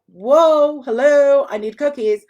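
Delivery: loud, high, thrilled dog wanting cookies; dog overjoyed and demanding cookies; excited dog voice. Retold or imitated. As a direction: burst in like a dog that just found treats. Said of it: "And then they're like".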